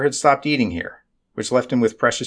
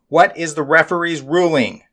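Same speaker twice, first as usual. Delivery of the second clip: shouting; 7 semitones higher, 4 dB louder than usual. A narrator's reading. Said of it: "Nestmom was shouting".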